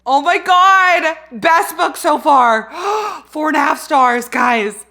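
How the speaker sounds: loud, slow, elated cry; excited shout. Real, not acted. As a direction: shout the verdict with joy. Just finished the book.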